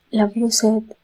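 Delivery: lightly voiced wife saying love through tears; tearful wife. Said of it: "கண்ணீருடன்". (கண்ணீருடன் மனைவியின் குரல், லேசாக)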